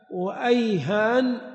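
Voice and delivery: أيهان ending in n instead of t; وأيهان, ayhān, closed on n; final n. Shown as a demonstration. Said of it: "تكون الأخيرة نون".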